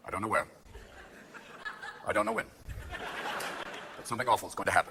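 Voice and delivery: low, quiet official statement; measured briefing, softly, with long pauses; Rumsfeld. Acted. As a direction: deliver a hushed, halting warning. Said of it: "Rumsfeld comes out and goes".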